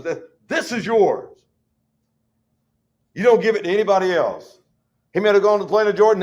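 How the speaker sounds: ordinary delivery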